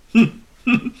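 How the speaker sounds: two playful snorts; mock huffing hmphs; teasing huff. A narrator's reading. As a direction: scoff lightly at her sulk.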